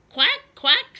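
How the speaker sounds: loud high quacking; duck quack. Acted. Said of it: "said the duck".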